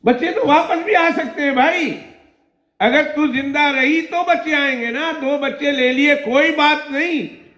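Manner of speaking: high mocking pharaoh voice; taunting tyrant. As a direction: sneer at her with false kindness.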